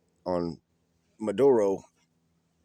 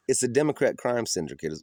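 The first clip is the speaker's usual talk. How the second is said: ordinary talk, nothing marked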